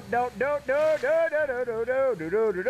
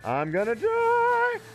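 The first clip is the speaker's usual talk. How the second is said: slow, high drawn-out wail; panicked wail; 12 semitones higher, slower than usual. Real, not acted. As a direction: stretch it out in real panic. Panicked in game chase.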